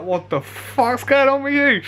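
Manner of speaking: put-on high, slow, alarmed boy's voice; startled stranger. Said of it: "he was like".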